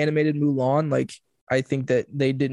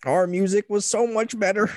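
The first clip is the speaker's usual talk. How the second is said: put-on higher grumpy old-timer voice; back in my day rant; 7 semitones higher than usual. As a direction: play a cranky old-timer. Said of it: "we'll just sound like old grumpy".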